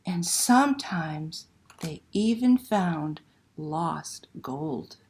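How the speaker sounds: slow, lively picture book reading; reading to children. Acted. Librarian reading Una to children online, expressive storytelling. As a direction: read it like a bedtime story to little ones.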